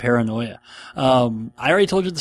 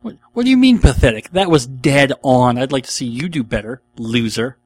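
mock indignant; 6 dB louder than usual. Answering the imagined heckler, hammed-up offended protest. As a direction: act wounded and fire back at the heckler.

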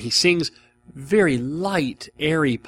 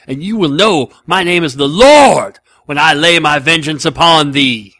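loud, thundering movie-hitman sermon; Jules at full fury; 4 semitones higher, 10 dB louder than usual. Acted. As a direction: hammer out the vengeful verse, punching key words. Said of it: "Sam Jackson as Jules in Pulp Fiction".

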